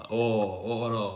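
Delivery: a lightly voiced genie. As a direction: greet like a surprised genie. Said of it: "really good impression of a genie".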